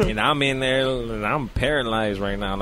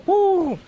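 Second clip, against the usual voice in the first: sudden high falsetto whoop; a quick triumphant woo; 8 dB louder than usual. Retold or imitated. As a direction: yelp it out high and loud. Retold moment of entering, whoop.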